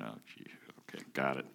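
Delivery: hushed, rueful "oh gee"; mock resignation. Preacher retelling his reaction to the verse, rueful hush. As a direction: sigh it out like you've been caught. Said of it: "I went".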